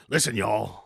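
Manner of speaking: low, hammed-up southern Nolte; southern Nick Nolte. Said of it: "Be like".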